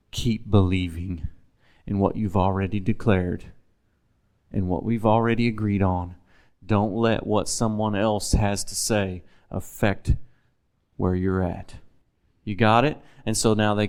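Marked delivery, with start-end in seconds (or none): 0.1-11.7 lower, softer, gently urging; soft reassurance. Retold or imitated. Preacher voicing Jesus softly encouraging Jairus. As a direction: coax him to keep believing. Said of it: "Or say it like this".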